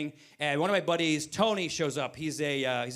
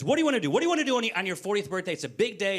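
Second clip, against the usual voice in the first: higher, insistent retold question; eager wife; 3 semitones higher, much faster than usual. Wife asking husband about his birthday plans, eagerly insistent. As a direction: pester eagerly, pitch jumping up.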